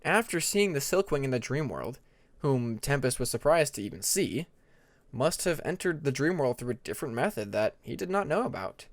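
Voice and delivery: ordinary delivery, nothing marked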